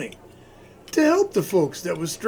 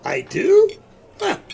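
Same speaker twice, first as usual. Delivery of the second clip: surprised; 12 semitones higher, 8 dB louder than usual. Acted. Surprised at being told he sounds like Santa.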